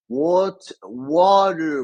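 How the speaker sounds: halting American "water" with a strong r; careful American "water" after a stumble; attempted American accent. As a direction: force out "water" in an American accent, high and loud. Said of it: "my American accent".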